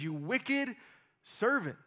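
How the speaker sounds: a touch of a stern king; stern rebuke. Acted. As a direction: rebuke him like an offended king. King rebuking servant, acted.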